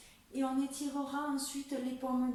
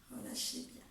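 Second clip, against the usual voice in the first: whispered; 8 dB quieter, faster than usual. Acted. Soft cue during relaxation.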